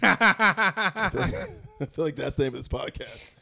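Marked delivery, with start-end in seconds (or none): 0.0-1.8 slow, laughing through the words; giggling, drawn out. Real, not acted. Breaks into laughter.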